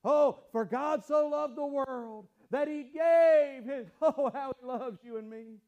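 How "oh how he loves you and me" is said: normal talking voice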